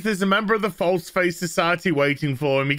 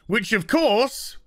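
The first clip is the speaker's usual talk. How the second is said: ordinary delivery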